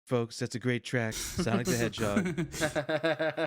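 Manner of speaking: lightly voiced Trump-style praise; a hint of a showy politician. Imagining Trump reviewing game music, lightly impersonated. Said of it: "thinking about Trump listening to video game music".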